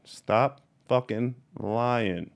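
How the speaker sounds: very slow, each word of "Stop fucking lying" set apart; slow emphasis. Real, not acted. Telling off fake breeders, slow and emphatic. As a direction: draw out every word with pauses between.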